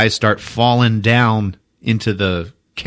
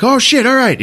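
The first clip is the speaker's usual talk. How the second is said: startled reply; 4 dB louder than usual. Retold or imitated. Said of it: "Colwyn's like".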